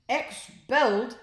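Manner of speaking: drawn-out high shout of a stern headmaster; headmaster's shout. A narrator's reading. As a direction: bark it out, slow and loud, as the furious head. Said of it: "Before Mister Roth could shout".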